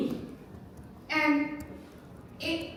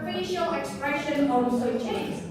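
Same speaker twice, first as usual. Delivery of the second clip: normal talking voice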